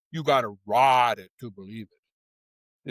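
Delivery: slow, low Southern drawl of a race driver; quoting the driver in a Southern accent. Retold or imitated. Driver looks at the camera, says the tagline in Southern accent. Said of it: "in his Southern accent".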